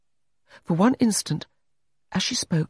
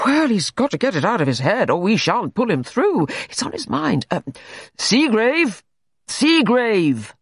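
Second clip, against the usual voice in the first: high, loud, blustering doctor; forceful, calling out the name; bullying doctor; 8 semitones higher, 4 dB louder than usual. A narrator's reading. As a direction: bark at the patient to rouse him. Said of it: "in that bullying manner".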